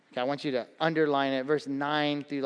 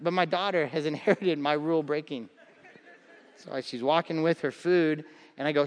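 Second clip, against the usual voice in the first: ordinary delivery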